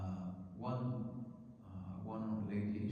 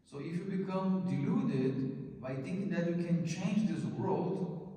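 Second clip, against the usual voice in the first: fiery preaching; 6 semitones higher than usual. Acted. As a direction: hammer home that God made everything. Preaching at full force.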